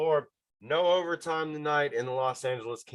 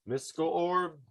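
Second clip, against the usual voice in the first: a lightly hammed-up incantation with a higher voice; mystical summons; 7 semitones higher than usual. Host calling on his mystical orb for a pick, mock solemn. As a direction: call on the orb like a fortune teller. Summoning the magic eight ball.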